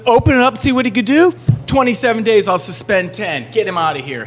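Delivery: high, loud, fast mock disbelief; mocking judge. Judge sneering at the lawyer's excuse, incredulous and loud. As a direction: throw the excuse back at him, incredulous.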